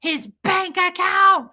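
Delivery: high mock rant building to a loud peak; retold screaming. Lady listing what the baby lost, imitated screaming. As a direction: list it as her escalating yell, last item stretched. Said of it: "she starts screaming at me".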